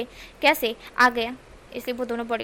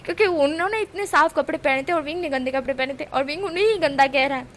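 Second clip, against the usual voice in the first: plain reading voice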